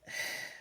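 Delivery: long breathy sigh; heavy exhale between thoughts. Real, not acted. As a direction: let out a wordless exhale. Pauses during emotional retelling.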